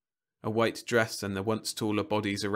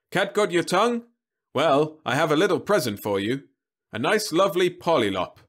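salesman robot; 8 semitones higher, 7 dB louder than usual. Acted. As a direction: greet him like a tireless vending robot. Robot offers a present.

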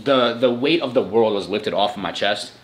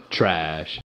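normal talking voice

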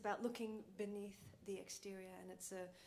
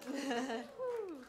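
excited; 6 semitones higher, 9 dB louder than usual. Real, not acted. Cheering after laugh.

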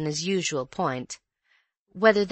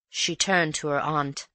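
ordinary delivery

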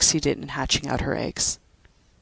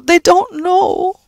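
even higher, louder, very slow brogue; sighing Irish echo; 10 dB louder, much slower than usual. Acted. Judy comforting Pat, repeating sadly. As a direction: repeat it, aching more.

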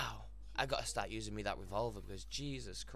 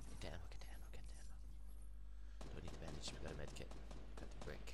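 whisper; 6 semitones lower, 8 dB quieter, much faster than usual. Real, not acted. Looting quietly.

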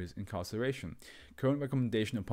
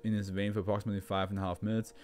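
usual speaking voice

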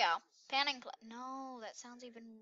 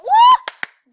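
high, loud cheer; triumphant woo; excited whoop; 25 dB louder than usual. Real, not acted. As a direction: whoop for the win. Cheering after getting gold.

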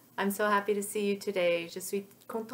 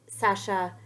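ordinary delivery

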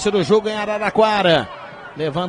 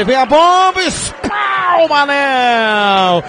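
excited commentary; 4 semitones higher, 3 dB louder, much slower than usual. Real, not acted. Save by keeper.

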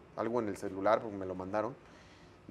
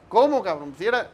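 higher, louder stunned outcry; shocked disbelief; 7 semitones higher, 7 dB louder than usual. Retold or imitated. Host caught off guard by Hugo, higher, louder outcry. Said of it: "pero yo".

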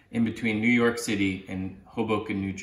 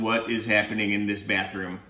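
mild starfish voice; 4 semitones lower, 5 dB quieter than usual. Acted.

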